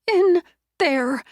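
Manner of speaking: high, loud frightened gasp of words; terrified. Acted. Shaken woman pointing out the dumbwaiter in fear.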